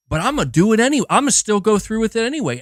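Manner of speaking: hammed-up reckless resolve; defiant Ellie. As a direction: rattle it off like you're doing it anyway. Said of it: "she was just like".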